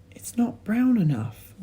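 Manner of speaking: put-on a worried boy noticing; George's voice. A narrator's reading.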